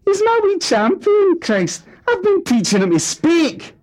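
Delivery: very high, loud, strained outrage; father shrieking denial at family; outraged denial. Acted. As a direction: explode in wounded defence. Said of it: "was too much for Fat Alan".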